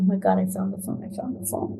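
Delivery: low, hushed and hurried under the breath; retold whisper. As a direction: barely voice the relief so he won't hear. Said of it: "and I'm going".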